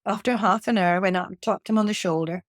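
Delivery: ordinary talk, nothing marked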